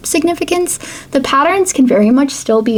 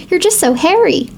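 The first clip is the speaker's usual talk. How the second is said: put-on higher, piping Capitol accent, sentence lifting at the end; silly Capitol accent; 7 semitones higher than usual. A narrator's reading. Venia the stylist to Katniss during waxing, piping Capitol accent. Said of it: "she pipes in her silly capital accent".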